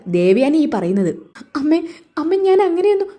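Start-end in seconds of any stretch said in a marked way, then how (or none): 1.5-3.1 high pleading. Acted. Daughter-in-law pleads while scolded.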